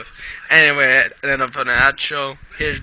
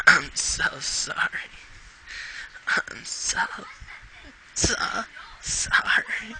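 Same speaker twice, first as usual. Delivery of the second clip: slow whispery sobs, breaking high at the end; sobbing apology; 9 semitones higher, 5 dB quieter, slower than usual. A narrator's reading. Pony sobbing an apology to her injured friend, breaking down. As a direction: break down crying, voice giving out then rising. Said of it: "gritted her teeth against the sobs".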